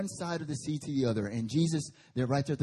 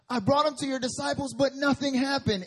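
higher, pleading father; lightly voiced upset father; a touch of a father's complaint, pitched up; complaining father; 7 semitones higher than usual.

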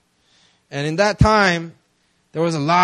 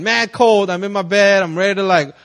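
usual speaking voice

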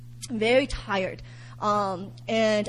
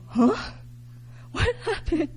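bewildered whisper; 6 semitones higher, 4 dB quieter than usual. Retold or imitated. Waking from vision, bewildered.

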